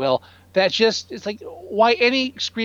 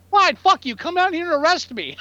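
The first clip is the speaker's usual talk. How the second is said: mock defiant yell; 9 semitones higher than usual. Mocking what he should have told the dispatcher, hammed-up yell. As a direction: fling it back at the dispatcher.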